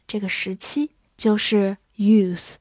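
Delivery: usual speaking voice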